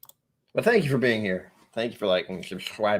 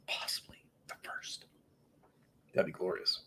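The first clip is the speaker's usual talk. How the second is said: whisper; 4 semitones lower, 14 dB quieter than usual. Real, not acted. Aside repeating his own line.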